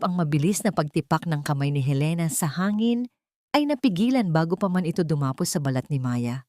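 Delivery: ordinary delivery, nothing marked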